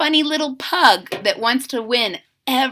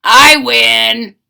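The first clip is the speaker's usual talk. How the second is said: 1 loud gloating; 10 dB louder than usual. Acted. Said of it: "He just said".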